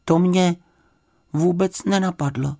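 sheepish high bear voice, with pauses; dejected little bear; crestfallen. A narrator's reading. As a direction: admit it haltingly, high and small. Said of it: "řekl Paddington schlíple".

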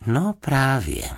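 pomalý, výše položený povzdech. A narrator's reading. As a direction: say it with a slow, worried sigh. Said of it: "povzdychla si paní Fousková". (ustaraný povzdech)

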